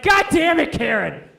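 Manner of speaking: high, loud enraged yell; furious shout. Acted. Killer screaming at his wife for not knocking, furious. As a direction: blow up at her for barging in.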